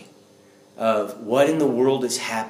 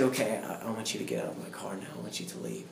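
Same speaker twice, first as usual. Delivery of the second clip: quiet dismissal; 3 semitones lower, 9 dB quieter, faster than usual. Retold or imitated. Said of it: "And he said".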